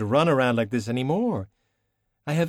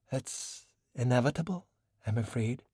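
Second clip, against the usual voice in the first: softer, a gentle sorry tone; sad regret; 3 semitones higher, 7 dB quieter than usual. Acted. China tells Valkyrie gently, sadly softer. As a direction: let it land softly with regret. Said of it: "China smiled sadly".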